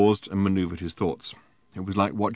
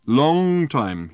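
put-on drunken drawl; 7 semitones higher, 7 dB louder than usual. Drunk Arthur dragging out words.

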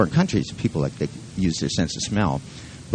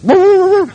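very high, loud mock dog woofing; imitated dog howl; 11 dB louder than usual. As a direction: let out a loud string of high woofs. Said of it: "and then it goes".

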